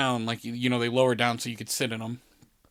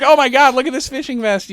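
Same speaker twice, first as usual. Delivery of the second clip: high, loud exclamation; mock excited kid; 12 semitones higher, 9 dB louder than usual. Little kid swimming in a huge vest, mock excited. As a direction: imitate a little kid marvelling loudly.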